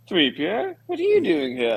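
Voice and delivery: lifted, delicate questioning voice; puzzled surprise. Retold or imitated. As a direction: imitate someone gently incredulous. Imitates Mon Mothma's surprise.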